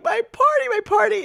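put-on high whiny child crying; mock crying child. Mom imitating young Lindsay crying, high and whiny. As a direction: imitate a little girl crying over her party. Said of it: "And you are crying".

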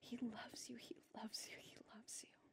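soft, fading to a near-whisper; hushed reassurance. Real, not acted. Tender repeated reassurance to congregation.

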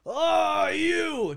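high, loud, drawn-out shout; retold angry yell. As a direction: imitate yelling at the other person. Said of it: "Just yell at the other person".

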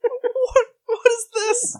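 high laughing outrage; laughing disbelief. Real, not acted. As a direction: squeal out in laughing shock. Attacked by frogs, laughing.

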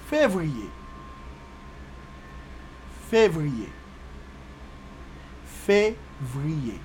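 février said as fev-ree-YAY; Creole pronunciation. Shown as a demonstration. Teacher giving learners February in Creole, février as fev-ree-yay. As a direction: model février for repeating, last take split into syllables.

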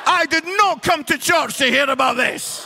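high, loud indignant shout through laughter; offended churchgoer. Retold or imitated. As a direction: play a scandalised congregant. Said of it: "They're like".

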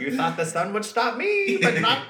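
high, loud gloating villain voice. Pretend. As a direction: taunt like a giddy dark lord.

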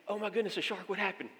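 imitated awestruck curiosity; astonished listener. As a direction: ask it as someone hooked on the tale. Hooked listener hears about the shark.

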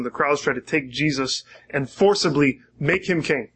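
normal talking voice

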